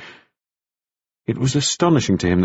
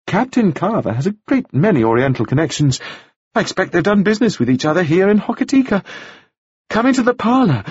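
higher, breezy, brisk society widow; 9 semitones higher, faster than usual. A narrator's reading. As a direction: brush it off lightly. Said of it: "with a breezy tone".

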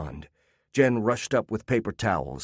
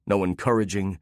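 usual speaking voice

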